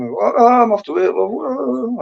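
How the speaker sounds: high rising jungle yell, half-joking; Tarzan yell. Retold or imitated. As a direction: hoot it out like swinging on a vine. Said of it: "like Tarzan did on them things".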